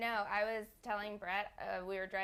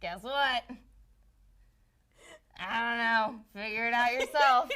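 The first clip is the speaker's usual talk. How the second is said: teasing; 3 semitones higher, 10 dB louder than usual. Acted. Teasing, laughing.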